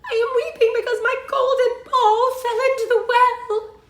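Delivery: high, loud mock wail; hammed-up high-pitched sobbing voice; weeping princess. A narrator's reading. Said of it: "she began to weep".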